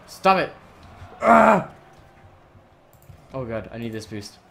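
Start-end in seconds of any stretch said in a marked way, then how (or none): 0.1-0.5 loud, higher command; urgent shout. Real, not acted. Urging builds to a shout. 1.2-1.7 short high shout; loud frustrated yell. Real, not acted. Scream as play fails.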